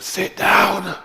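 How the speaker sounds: high, loud headmaster command; head teacher bark. Retold or imitated. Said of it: "Like his head teacher".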